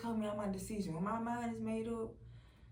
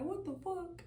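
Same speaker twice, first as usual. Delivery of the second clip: startled; 9 semitones higher than usual. Retold or imitated. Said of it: "I jumped".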